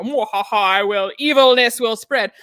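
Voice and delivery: mock evil laugh into a villain's boast; a lightly voiced mustache-twirling baddie. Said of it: "mustache twirling".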